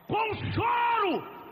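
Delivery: slow pleading shout; drawn-out cry. Acted. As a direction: stretch it out as a crying plea. Preacher crying out about weeping.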